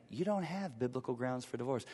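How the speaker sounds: quietly calm counselor. Retold or imitated. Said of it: "the counselor calmly was saying".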